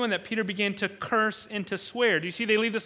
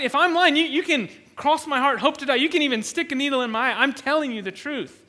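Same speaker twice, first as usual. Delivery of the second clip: louder, higher, protesting schoolkid oath; schoolyard oath; 4 semitones higher, 4 dB louder than usual. Acted. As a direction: protest your honesty loudly, playground-style. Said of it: "this is my elementary school translation".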